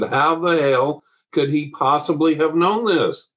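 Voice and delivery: can't believe what she's hearing; stunned disbelief. Retold or imitated. Shocked wife pressing the parents about the vision, stunned and slow. Woman repeats her disbelief, more insistent.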